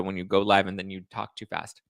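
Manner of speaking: ordinary talk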